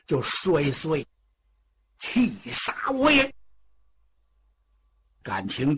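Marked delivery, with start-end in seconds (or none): 2.0-3.3 slow, high anguished outburst; a prince's grief-stricken roar; furious cry. Acted. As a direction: wail out his rage at the news. Prince learns mother died.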